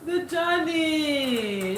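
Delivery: name stretched very long, high; high, drawn-out call to a viewer; drawn-out exclamation. Real, not acted.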